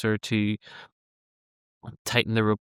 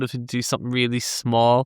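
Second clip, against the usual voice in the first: ordinary talk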